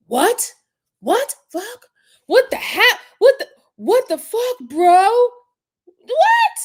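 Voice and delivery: rising, loud imitation of outraged disbelief; shocked and angry. Commentator imitating the husband's reaction, shocked and angry. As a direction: climb to a high, incredulous mock yell. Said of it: "Not only shocked, but angry".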